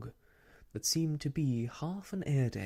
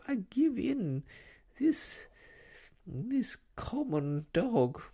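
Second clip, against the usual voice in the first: tearful fussy lady voice; 11 semitones higher, 3 dB louder than usual. Acted.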